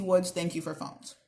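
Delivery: usual speaking voice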